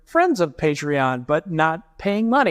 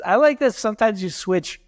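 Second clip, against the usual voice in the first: ordinary delivery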